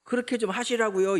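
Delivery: high, indignant appeal for God to act; exasperated plea. Retold or imitated. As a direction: whine it up at God, half-complaining. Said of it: "이런 거예요".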